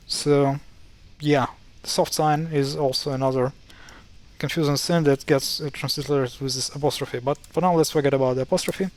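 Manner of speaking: ordinary talk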